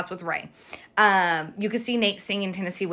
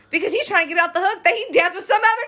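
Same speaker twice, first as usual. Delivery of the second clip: high, excited; 10 semitones higher, faster than usual. Real, not acted. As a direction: pitch way up, fast rant. Mocking Nate's excuse.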